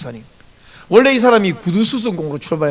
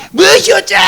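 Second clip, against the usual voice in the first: put-on loud, high snarling outburst of an angry drunk; drunk man's angry shout; 10 semitones higher, 6 dB louder than usual. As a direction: bark it at the stranger who bothers you. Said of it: "성질을 내면서 막 쳐들어가는".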